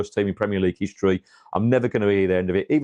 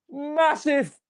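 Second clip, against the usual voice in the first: mock squeal. Retelling director's insult, high squeal.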